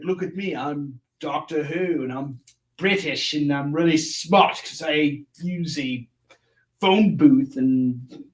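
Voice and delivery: a half-hearted mock British accent for Doctor Who; bad British accent. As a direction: lightly put on British voice, poking fun. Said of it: "I'm just not good with that accent".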